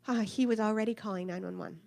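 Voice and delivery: usual speaking voice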